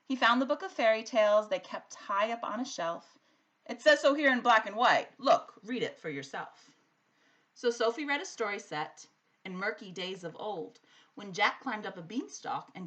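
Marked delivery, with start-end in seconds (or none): 3.6-6.7 emphatic dad. A narrator's reading. Dad pointing at the fairy tale.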